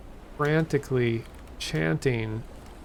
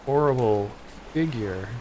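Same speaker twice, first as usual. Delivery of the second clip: plain reading voice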